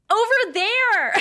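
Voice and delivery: put-on high excited child shout; mock call out like a kid. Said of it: "They love shouting things like".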